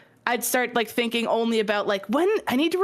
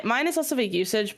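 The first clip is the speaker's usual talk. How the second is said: ordinary talk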